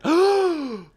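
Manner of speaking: loud, high mock gasp; exaggerated gasp of horror; shocked gasp. Host reacts to a viewer's confession, theatrical gasp.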